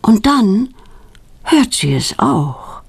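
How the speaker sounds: ordinary delivery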